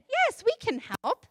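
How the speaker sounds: high, bright little duck saying yes. Acted. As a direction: pipe up eagerly as a duck.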